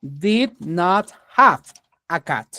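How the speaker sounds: each English word drawn out for the class; teacher spelling out the sentence very slowly; slow model sentence. Shown as a demonstration. Teacher modelling the sentence for students, slow. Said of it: "¿Queda claro?".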